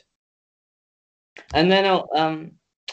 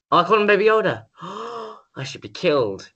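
mock horror; 5 semitones higher than usual. Mock horror at own naming slip.

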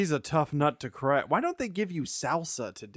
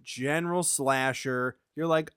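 ordinary talk, nothing marked

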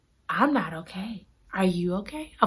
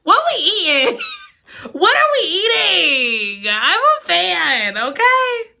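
excited gushing; 9 semitones higher, slower than usual. Retold or imitated. Reader answering the heroine, squealing with excitement. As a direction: gush over the date like a giddy friend. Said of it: "I said".

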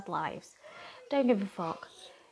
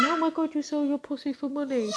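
mocking critic; 7 semitones higher, 3 dB louder than usual. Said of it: "so much fucking criticism".

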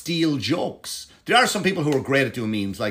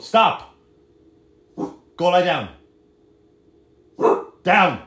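sharp, loud command to the dog; firm dog command; 6 semitones higher, 6 dB louder, much slower than usual. Real, not acted. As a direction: order the dog down, loudly. Ordering the dog to lie down.

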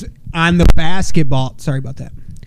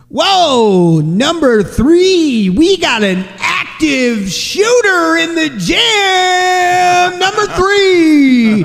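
slow, stretched, high announcer call; mock announcer; 11 semitones higher, 3 dB louder, much slower than usual. Dad pretending to be the gym announcer, hammed up. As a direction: boom it out like an arena announcer. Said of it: "be the fucking announcer".

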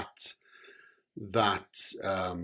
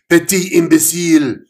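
loud, higher enraged French shout; furious cop roaring at Omen; 8 semitones higher, 10 dB louder than usual. Acted. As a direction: roar the insult. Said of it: "he roared".

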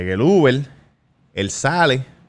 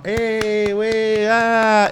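high mock Mexican announcer shout, güey; playful high Mexican-style call; mock Mexican hype call; 9 semitones higher, much slower than usual. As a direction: hype him up like a Mexican emcee. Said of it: "Cuando me anunciaron los mexicanos".

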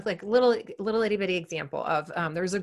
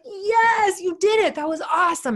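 much higher, louder cheering praise; excited praise; 11 semitones higher, 5 dB louder than usual. Retold or imitated. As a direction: cheer her on like she just nailed it. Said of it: "And I was like".

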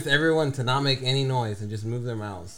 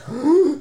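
high sharp mock gasp; 13 semitones higher, 6 dB louder than usual. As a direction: gasp like a panicked guy. Tripping guy retold crying.

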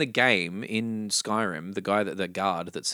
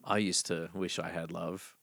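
quoted meme voice; 3 semitones lower, 7 dB quieter than usual. Acted. Friend quoting an old game meme, soft and measured. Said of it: "a lot of people would be like".

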